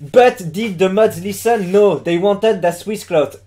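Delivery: slow, deliberate English with a thick French accent; basic French accent. Shown as a demonstration. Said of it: "je tente l'accent français de base". (accent français de base en anglais)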